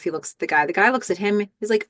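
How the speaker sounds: normal talking voice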